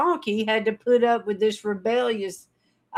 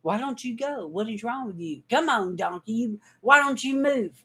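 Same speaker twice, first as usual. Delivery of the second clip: frustrated prophet; 4 dB quieter than usual. Acted.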